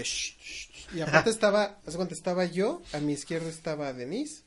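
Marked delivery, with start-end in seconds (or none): 0.0-1.0 repeated "shh" hiss; soft, voiceless shushing imitation; imitated shushing. Retelling an annoying moviegoer, imitated shushing. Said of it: "y era así de".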